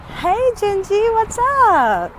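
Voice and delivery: high, bright greeting to a passing neighbor; cheery call-out. Real, not acted. Vlogger greeting neighbor Gingy on her walk, cheery high call. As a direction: call out a happy hello.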